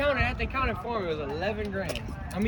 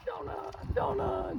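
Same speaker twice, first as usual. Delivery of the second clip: high, slow sing-song call; mock high calling voice; Eddie calling Jonah; 13 semitones higher, 9 dB quieter, slower than usual. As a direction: stretch the name out like a taunt from afar. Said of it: "and he's like".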